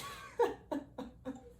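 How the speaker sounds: breathless high-pitched cackle; real helpless laugh at the memory; high wheezing laugh. Real, not acted. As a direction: crack up in a silent wheeze. Cracking up at memory.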